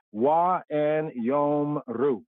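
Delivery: Wa and Yom Ru, slowly syllable by syllable: wa, then yom, then ru; slow spelled-out Hebrew. Shown as a demonstration. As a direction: break Wa and Yom Ru into slow parts. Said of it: "we say Wayomru".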